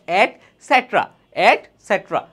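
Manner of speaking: "এটসেট্রা" as et, then set-ra; teacher's careful et-SET-ra for "এটসেট্রা"; correct pronunciation. Shown as a demonstration. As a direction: repeat "এটসেট্রা" clearly for the class. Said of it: "আসলে এটার উচ্চারণ হচ্ছে".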